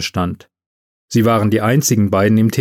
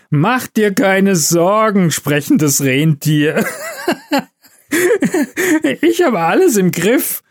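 slow, high, tipsy reassurance with giggles; laughing Santa; 8 semitones higher, slower than usual. A narrator's reading. Stoned Santa reassuring an elf he calls reindeer, laughing. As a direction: drawl it grandly and crack up laughing. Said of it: "lachte der Weihnachtsmann".

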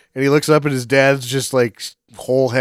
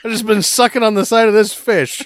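higher, louder giddy parents, laughing through; mock ghost parents; 11 semitones higher, 4 dB louder than usual. Dead parents chatting cheerfully to their son, giddy and laughing. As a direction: ham up the silly dead parents, giggling. Voicing parents drinking lake water.

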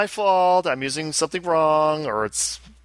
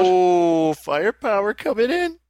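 excited squeal; 12 semitones higher, 4 dB louder, much slower than usual. Real, not acted. Excited squeal over new game.

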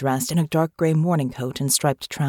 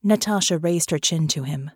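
usual speaking voice

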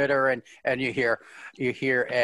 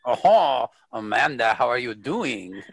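put-on lightly done French-English accent, a little lower; a mild French lilt as the captain greeting an old friend; captain greeting Amanda warmly in a faint French-English accent; Picard's French-English accent; 4 semitones lower than usual. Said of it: "Your accent's just kind of, whew".

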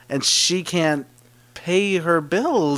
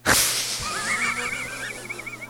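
high laugh; 8 dB quieter than usual. Real, not acted. Laughs at own horse bit.

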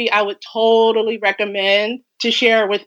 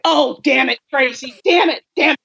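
exasperated imitation of dad, slightly raised pitch; mock upset dad; 4 semitones higher than usual. Dad on the phone hearing Dumplings, mock upset. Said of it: "He just gets so upset".

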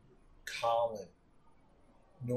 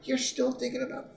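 high amused teasing voice, pitched up; imitating a teasing friend; 12 semitones higher than usual. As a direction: needle him about still caring. Patrice teasing again.

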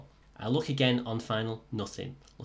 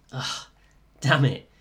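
frustrated curse; 3 semitones higher, 8 dB louder, slower than usual. Real, not acted. Solo takeoff told to hold.

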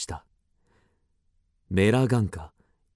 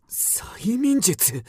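slow, stunned, high question; surprised; 10 semitones higher, much slower than usual. Acted. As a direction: draw the word out in shock. Startled by the word hypnotism.

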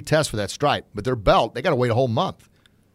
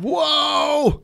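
amazed whoa; 6 dB louder than usual. Real, not acted. Dad amazed at son's motto.

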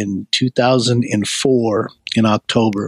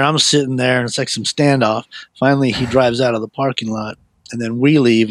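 ordinary talk

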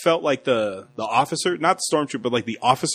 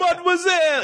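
very high, lilting French call; hammed-up courteous French greeting; French accent. Podcaster playing the officer at the door, high French accent. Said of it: "he switches back to French".

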